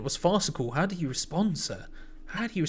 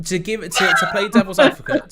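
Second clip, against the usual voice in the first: laughing shout; 3 semitones higher, 8 dB louder than usual. Real, not acted. Laughing restart.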